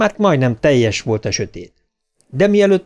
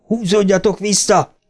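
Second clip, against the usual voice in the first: raised, high-pitched warning cry; shouted warning; 7 semitones higher than usual. A narrator's reading. Ben shouts to his friends to pull back, shouted warning. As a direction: shout a warning with pitch lifted, volume moderate. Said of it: "kiáltott rájuk Ben".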